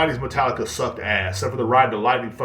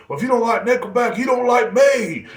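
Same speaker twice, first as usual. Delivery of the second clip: high, louder mock yell, slowing at the end; imitated scream; 12 semitones higher, 6 dB louder than usual. Drunk friend screaming at him, high mock yell. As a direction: yell it like a drunk offended fan. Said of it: "And he would scream that".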